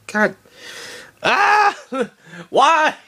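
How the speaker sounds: high groan into a laughing shout; real frustration, laughing it off; exasperated laughing cry of why. Real, not acted. Frustrated at the scene.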